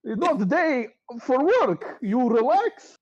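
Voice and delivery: very high, slow, loud fake cheer; flustered barkeep. Durnan shooing Bonnie away, high and loudly flustered. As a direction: cover up loudly with forced friendliness.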